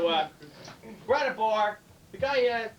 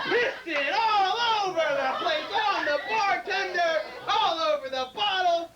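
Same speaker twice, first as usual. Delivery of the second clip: high, slow, stretched comic narration; drawn-out climax; 8 semitones higher, slower than usual. A narrator's reading. Comic visualizing the mess for audience, high and drawn-out. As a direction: draw out each phrase, pitched way up.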